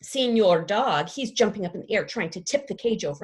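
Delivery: ordinary delivery, nothing marked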